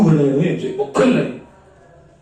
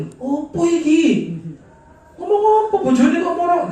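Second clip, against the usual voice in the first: higher and louder, a half-laughing protest; mock indignant husband; 11 semitones higher, 5 dB louder than usual. Husband protesting to his wife, mock indignant. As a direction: play the offended husband, laughing as he complains.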